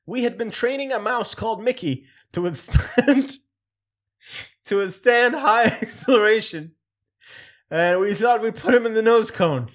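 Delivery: can't keep a straight face reading it; laughing through a quote. Retold or imitated. Vlogger reading Manoogian's mouse story aloud, laughing through it.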